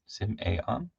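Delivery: quiet, careful "sim-AY-on?" for Simeon; questioning pronunciation. Real, not acted. Streamer sounding out a viewer's name, questioning pronunciation. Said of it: "Is that right?".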